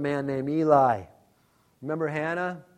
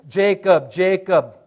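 calling out; 2 semitones higher, 7 dB louder, slower than usual. Acted. God calling Jacob.

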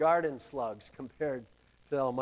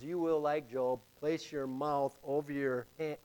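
ordinary delivery

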